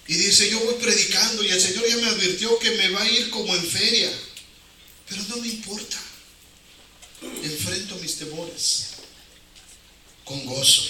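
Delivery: normal talking voice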